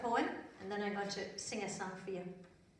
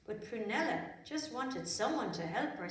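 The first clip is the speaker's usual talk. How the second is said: plain reading voice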